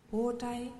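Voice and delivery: usual speaking voice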